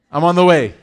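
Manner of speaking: loud casual excuse. Retold or imitated. Repeats the friend's excuse louder.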